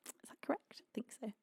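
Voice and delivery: unsure whispered aside. Real, not acted. Checking a word with chat, whispered. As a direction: whisper it to yourself.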